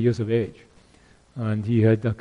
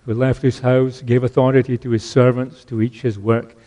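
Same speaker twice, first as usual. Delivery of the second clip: ordinary talk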